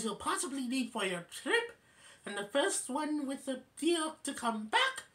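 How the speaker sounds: high put-on hostess voice; Claudia. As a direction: pitch it up, announcing the terms. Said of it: "And then Claudia says".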